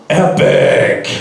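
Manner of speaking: very low, loud metal growl; growled exclamation. Retold or imitated. Stunned rider hailing the image on his visor, low growl. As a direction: roar it out deep and drawn.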